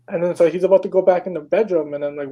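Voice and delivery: normal talking voice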